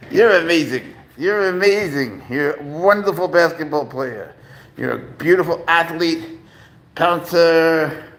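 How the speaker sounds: high, doting praise for a cat; pet praise. Real, not acted. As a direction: gush over your cat like a proud parent.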